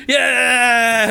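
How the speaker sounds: loud happy yell; cheering. Acted. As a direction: whoop like a proud winner. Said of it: "the half orc goes".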